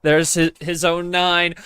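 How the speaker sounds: ordinary talk, nothing marked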